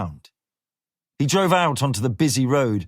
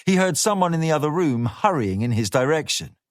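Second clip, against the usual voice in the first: plain reading voice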